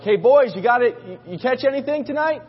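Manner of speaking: high call across the water. Retold or imitated. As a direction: imitate hailing a boat from shore. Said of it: "They're shouting back and forth".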